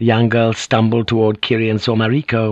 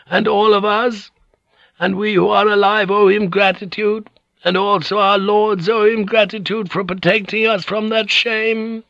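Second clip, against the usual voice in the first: put-on hoarse, frail old lady; 11 semitones higher than usual. A narrator's reading. Dying noblewoman bears witness to the samurai, hoarse and frail. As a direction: declare it formally in a thin, high old voice. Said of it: "her voice hoarse and frail in the silence".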